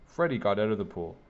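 ordinary delivery

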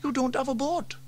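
put-on high boy voice, unsure; young boy James shrugging it off. A narrator's reading. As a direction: voice the young brother. James delivering the punchline.

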